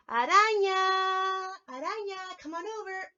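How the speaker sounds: calling the puppet in. Real, not acted. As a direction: stretch the name out to summon her. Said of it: "we're gonna call, um, her name".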